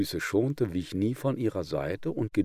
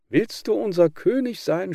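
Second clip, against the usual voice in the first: high, loud indignant cry; frogs crying out; 12 semitones higher, 6 dB louder than usual. Acted. Frogs crying out at the water snake. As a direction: shout up at the new king. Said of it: "schrien die Frösche".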